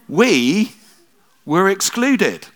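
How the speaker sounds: slow, loud, high excluded; emphatic punchline. Real, not acted. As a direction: land the word excluded hard. Punchline of the trousers story.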